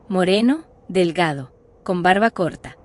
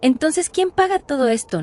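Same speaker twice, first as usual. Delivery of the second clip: incredulous question; 7 semitones higher than usual. Acted. Narrator questions how she pays.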